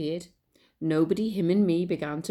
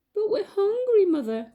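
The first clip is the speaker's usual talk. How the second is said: put-on very high, louder child voices; pleading little mice; 14 semitones higher, 5 dB louder than usual. Little mice begging their mother, high pleading. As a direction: beg like tiny hungry mice. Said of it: "cried the three little mice".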